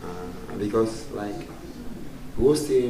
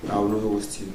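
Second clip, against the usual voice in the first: ordinary talk, nothing marked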